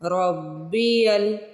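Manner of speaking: रब्बियल with a doubled bb; रब्बियल, ra joined to ba. Shown as a demonstration. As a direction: hold the bb in रब्बियल. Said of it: "रा को बा से मिलाना है।".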